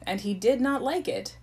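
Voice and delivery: ordinary delivery, nothing marked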